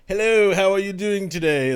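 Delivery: a hint of a put-on British accent; chirpy British greeting, lightly done; mock British accent. Shown as a demonstration. Showing how he practices accents at work, mock British. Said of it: "speaking in British all morning".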